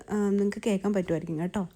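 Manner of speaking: usual speaking voice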